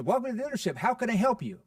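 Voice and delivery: loud, high, gushing welcome; pushy dealer bursting with excitement at the door; overexcited car salesman. Retold or imitated. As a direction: oversell the greeting with huge enthusiasm. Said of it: "Or, you know".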